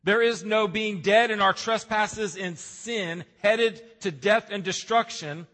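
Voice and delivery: building preaching. Acted. Pastor driving the point home to the congregation, building intensity. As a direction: build toward the climax of the sermon.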